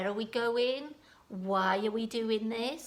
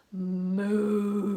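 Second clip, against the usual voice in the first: mooing like a cow; 2 semitones lower, 5 dB louder than usual. Shown as a demonstration. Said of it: "this animal".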